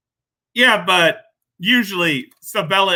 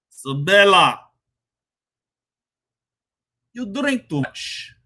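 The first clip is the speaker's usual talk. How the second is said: put-on Russian accent; 4 semitones higher than usual. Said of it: "Let me get my Russian accent ready".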